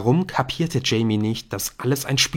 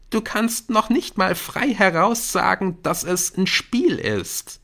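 higher-pitched pointed accusation; reproachful; 9 semitones higher than usual. Acted. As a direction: confront your friend, exasperated. Jamie accuses Nick.

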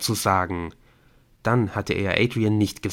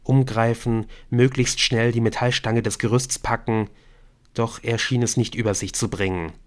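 ordinary delivery, nothing marked

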